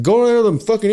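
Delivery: high-pitched imitated impatience; worked-up foreman impression; exasperated boss. Exasperated boss repeats order.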